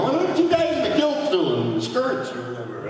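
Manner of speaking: very high, loud squawked question; exaggerated high-pitched shout; mock announcer call. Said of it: "Little dolly and going".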